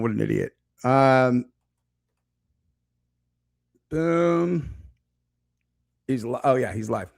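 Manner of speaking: talking while chewing gum; words round a wad of gum. Real, not acted. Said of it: "I'm just chewing into a fucking microphone".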